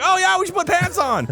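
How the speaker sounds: high, hammed-up agreement; mock-eager cartoon reply, high; sudden realisation. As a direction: pitch it up as if it just dawned on you. Voicing brain's sudden realisation.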